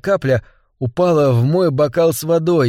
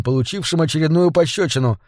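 plain reading voice

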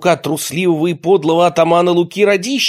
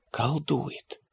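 hushed whisper of a conspirator; 12 dB quieter than usual. A narrator's reading. Luka whispering to his companions. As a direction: breathe it out, barely voiced. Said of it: "прошептал Лука".

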